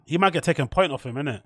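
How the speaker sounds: ordinary delivery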